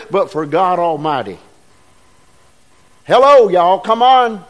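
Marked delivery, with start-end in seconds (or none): 3.1-4.4 louder call to rouse the room; loud wake-up call. Real, not acted. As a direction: shout them awake. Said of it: "the only one awake".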